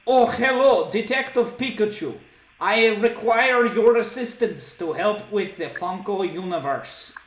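high, loud mock Russian accent; hammed-up Russian-accented heroine; Wonder Woman. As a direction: appeal for help in a thick Russian accent. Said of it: "she just had a thick Russian accent".

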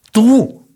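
loud, high punch on Tú; emphatic you. Real, not acted. Podcaster telling listeners who lives their life, emphatic loud Tú. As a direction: hit Tú hard and high, like a reveal. Answering his own question to listeners.

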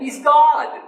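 high, loud burst through a laugh; laughing exclamation. Real, not acted. Preacher to congregation, laughing in delight. Preacher stressing Jesus is God.

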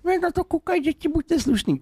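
very high mocking whine; mock a prissy complainer; whiny commenter. Mocking people who say kids watch, high whiny voice. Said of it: "jak vždycky ty lidi".

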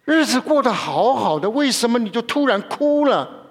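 a wife alarmed that her husband suddenly weeps; startled wife. Retold or imitated. Wife startled at her weeping husband, high alarmed cry. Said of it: "她说".

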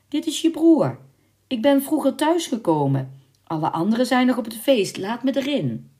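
high, loud put-on brother voice; disguised voice. A narrator's reading. Servant posing as her brother at the window, voice disguised. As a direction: disguise your voice, pitched well up. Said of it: "zei met een verdraaide stem".

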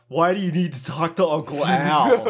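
loud, high counselor bellow; comic outraged imitation; mock angry retort. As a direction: yell back defensively, hammed up. Imitates Larry yelling back.

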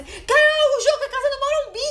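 high, loud mock phone shriek; frantic excited yell. Friend calls Carol about the wedding news, mock-frantic yell.

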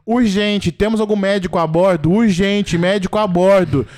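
loud, urgent intercom call; hammed-up urgent cabin announcement; flight attendant on radio. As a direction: announce it urgently over the plane's speaker. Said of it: "pegando o radinho e fazendo assim".

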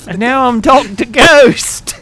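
slow, loud spooky announcement; grand ghost. Pretend. Joking he died and became a ghost, slow and loud. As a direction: proclaim yourself a ghost.

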